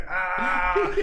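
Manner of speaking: hammed-up cry of pain; mock scream of pain. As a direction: wail it for comic effect. Acting out pain in nurse's office.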